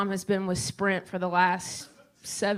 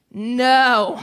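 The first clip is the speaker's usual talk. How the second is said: loud flat no; blunt no; 3 semitones higher, 12 dB louder than usual. Retold or imitated. Sprint agent rejecting mom's answer, loud blunt no. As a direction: slam down a curt rejection.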